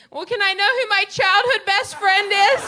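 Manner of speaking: very high, loud indignant mom; mom's punchline. Retold or imitated. Mom demanding to learn her childhood friend from Sprint, high loud outrage. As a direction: belt out mom's last outraged question.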